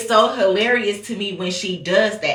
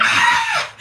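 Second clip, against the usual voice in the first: sudden high shriek; psychic shriek. Retold or imitated. Mimicking psychics on TV, a sudden high shriek. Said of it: "and they be like".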